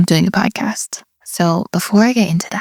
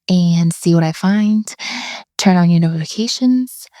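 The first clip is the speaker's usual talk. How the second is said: ordinary talk, nothing marked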